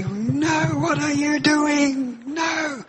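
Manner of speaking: high, loud, slow scolding of the people; Moses scolding. Retold or imitated. Moses pleading with people going back to legalism, high and exasperated. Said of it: "he'd say".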